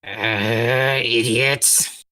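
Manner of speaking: low grumble to himself; a low, grumbling curse; grumbled. Acted. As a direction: mutter it under your breath, annoyed. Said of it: "he grumbled to himself".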